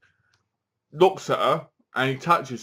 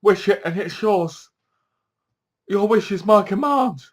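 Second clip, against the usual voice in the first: higher, lightly hammed-up genie voice; a hint of creepy genie, raised in pitch; 7 semitones higher, 4 dB louder than usual. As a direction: imitate a creepy wish-granter. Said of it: "it sounds like he's really creepy".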